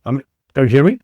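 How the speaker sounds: glitchy line, words cutting out; call dropping out. Real, not acted. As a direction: voice breaking up on a weak connection. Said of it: "the signal keeps cutting out".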